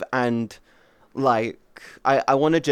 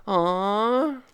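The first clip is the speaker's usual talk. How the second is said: aww; 9 semitones higher than usual. Real, not acted. Reacting to chat, cooing.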